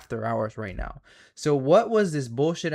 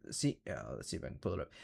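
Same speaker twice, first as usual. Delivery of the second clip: soft, low to himself; muttered aside; 12 semitones lower, 14 dB quieter, much faster than usual. Real, not acted. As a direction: mumble while searching. Muttering while pulling up chart.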